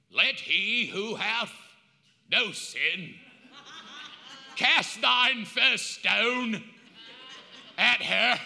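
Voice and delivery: slow, measured pronouncement; slow solemn King James voice; solemn Jesus. Acted. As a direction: deliver it slowly like scripture. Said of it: "I don't know if that's how he talked".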